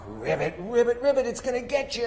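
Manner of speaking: put-on high, fast froggy ribbit for a toddler; playful frog voice. Parent playing with a toddler, playful froggy voice. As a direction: croak it like a toy frog coming to get you.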